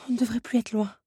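hushed whisper. Acted. A companion whispers to Belle that the portal is near, whispering. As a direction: breathe it out as a whisper to stay unheard. Companion answers while fleeing.

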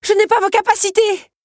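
very high, loud complaint; left-behind runner's pleading yell; protesting shout. A narrator's reading. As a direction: shout your protest after someone racing ahead. Belle calls after the running beast.

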